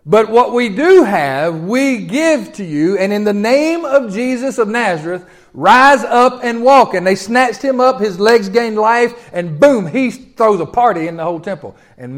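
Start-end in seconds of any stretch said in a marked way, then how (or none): 0.1-6.9 slow, weighty, louder healing command; solemn command. Acted. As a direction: pronounce it slowly with authority. Peter heals the cripple.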